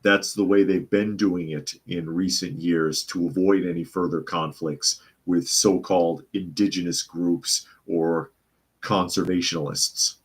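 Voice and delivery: ordinary talk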